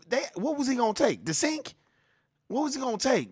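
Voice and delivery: high, disbelieving questions; incredulous. Real, not acted. Streamer mocking the claim, incredulous high questions. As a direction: scoff at the idea he'd steal.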